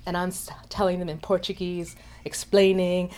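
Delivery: hushed whisper. Real, not acted. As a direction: drop into a confiding whisper. Tells the story in a hush.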